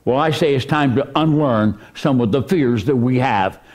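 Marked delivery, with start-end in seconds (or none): none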